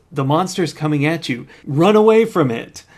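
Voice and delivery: calling out loudly to actors; shouting director. Retold or imitated. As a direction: yell the direction, building up. Director yelling at scared actors.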